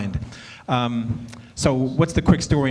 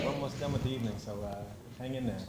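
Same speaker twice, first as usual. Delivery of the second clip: ordinary talk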